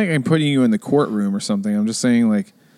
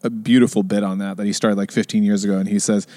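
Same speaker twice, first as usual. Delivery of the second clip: usual speaking voice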